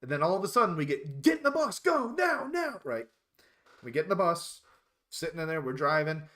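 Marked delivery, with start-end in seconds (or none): 1.2-2.8 much higher, loud barked orders; yelled orders. Retold or imitated. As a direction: hurry recruits onto the bus. Drill instructor yelling orders.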